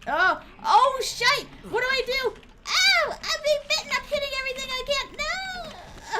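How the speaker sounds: high, loud frantic cries; panicked yelling. Real, not acted. Streamer yelling in panic while being bitten. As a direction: scream while flailing at the attacker.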